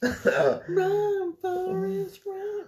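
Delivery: high, straining call; Jenny's cry. Retold or imitated. Friend quoting Jenny's call to Forrest, high strained cry. Shouting movie line.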